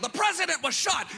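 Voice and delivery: put-on high, excited kid yell; kid's excited yell. Kid yelling news outside hedge, high excited cry. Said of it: "he yelled".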